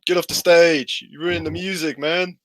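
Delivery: loud booing yell at the bard; angry heckler shout. Acted. Heckler shouting at the flute player, loud and angry. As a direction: shout him off the stage. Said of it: "and he goes".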